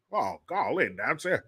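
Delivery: put-on low, soft, mumbling old Southern senator; Mitch McConnell impression. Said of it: "I'm Mitch McConnell".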